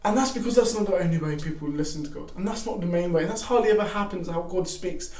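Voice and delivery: usual speaking voice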